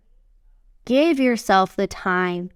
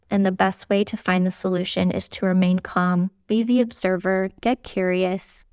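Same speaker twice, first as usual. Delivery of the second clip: ordinary delivery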